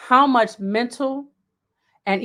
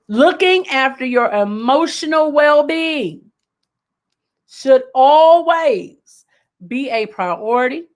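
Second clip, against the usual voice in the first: louder for the back; 2 semitones higher, slower than usual. Shown as a demonstration. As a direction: project it to the people in the back row. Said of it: "say that a little louder".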